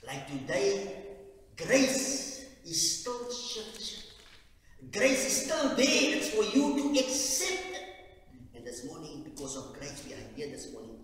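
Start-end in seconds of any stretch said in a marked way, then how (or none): 0.1-7.8 high, loud fervent preaching; preaching at full force. Acted. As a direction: proclaim it to the whole church, loud and high.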